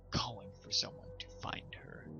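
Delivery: usual speaking voice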